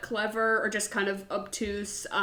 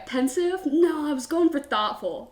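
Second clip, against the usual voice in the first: imitating the pouty line; 5 semitones higher than usual. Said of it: "And he goes".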